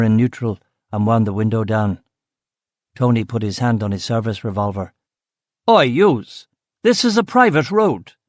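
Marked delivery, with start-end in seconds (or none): 5.7-8.1 angry yell from a landowner. Acted. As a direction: shout him off the road. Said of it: "the man yelled".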